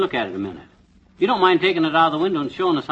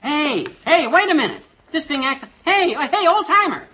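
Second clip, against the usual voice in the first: high, excited holler; urgent calling out; 8 semitones higher, 3 dB louder than usual. Acted. Husband calling the Old Timer back, urgent holler. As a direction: call him back before he gets away.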